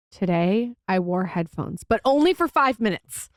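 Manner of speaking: usual speaking voice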